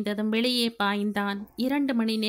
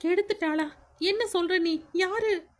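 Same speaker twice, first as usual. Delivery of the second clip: higher, startled questions; mock shock; 9 semitones higher than usual. [name] faking shock at Anu's news. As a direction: act out shocked disbelief.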